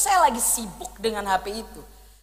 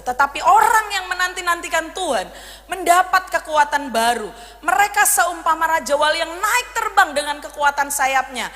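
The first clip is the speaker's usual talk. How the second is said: firm, louder reading of the verse; scripture reading; 5 semitones higher, 5 dB louder than usual. Acted. Preacher reads Isaiah aloud to the congregation, firm and proclaiming.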